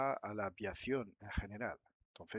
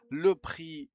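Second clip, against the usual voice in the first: louder, higher reading of the field; announced field; 6 semitones higher, 10 dB louder than usual. Shown as a demonstration. Teacher reading the next form field to learners, louder. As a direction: call the field out clearly.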